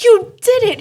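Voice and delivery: high, loud triumphant shout; elated, full-voiced exclamation; excited cheer. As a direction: jump up and cheer their breakthrough. Jumped up celebrating his breakthrough.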